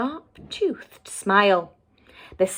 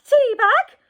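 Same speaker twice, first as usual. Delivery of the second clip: put-on much higher, loud startled old lady's cry; grandma shout; 6 dB louder than usual. A narrator's reading. Grandma crying out as the wolf pounces, high and loud. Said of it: "before Grandma could shout".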